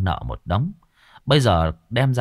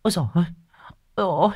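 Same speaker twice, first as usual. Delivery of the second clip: high, rising frantic wail; panicked wailing woman; 4 dB louder, faster than usual. Acted. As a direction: cry out to the heavens in panic. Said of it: "vừa thở hổn hển". (giọng đàn bà hốt hoảng kêu trời)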